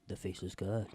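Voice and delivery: a hint of a low, soft villain voice; lightly voiced, hushed dragon lord; Dragon Hagar impression. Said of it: "your Dragon Hagar impression".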